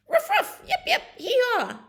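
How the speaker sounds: very high, squeaky barking into a whoop; high, gleeful barks and yee-haw; excited dog barks. Acted. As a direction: yap like an eager herding dog. Dog sets on supposed cow.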